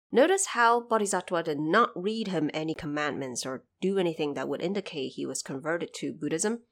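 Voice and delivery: ordinary delivery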